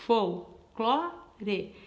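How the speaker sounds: "folclore" in three slow beats, fol-clo-re; "folclore" split slowly into its three syllables. Shown as a demonstration. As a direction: count out the syllables of "folclore" by pausing after each. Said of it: "pronunciar a palavra folclore".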